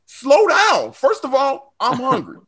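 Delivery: high incredulous protest. Retold or imitated. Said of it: "I was like".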